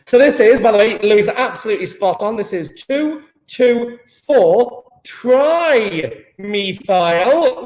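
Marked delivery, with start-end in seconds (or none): 2.7-7.6 slow, spelled-out naming. Shown as a demonstration. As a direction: drag out each number. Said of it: "teacher spells out name slowly".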